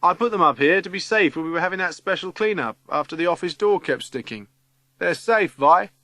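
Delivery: shouting down. A narrator's reading. Cyril calling from a high cupboard to Vi, shouting. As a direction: call the good news down. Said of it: "Cyril, shouts down".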